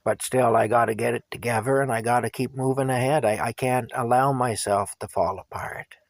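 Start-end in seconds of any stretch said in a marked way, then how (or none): none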